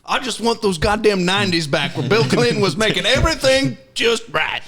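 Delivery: put-on high, loud grumpy old voice; old man rant. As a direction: whine like a nostalgic old coot. Nostalgic old guy rant.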